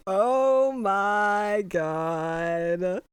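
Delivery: very drawn-out, overwhelmed gasp of words; slow, swooning fangirl reaction; overwhelmed delight. Real, not acted. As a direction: stretch every word in giddy disbelief. Overwhelmed by the kiss.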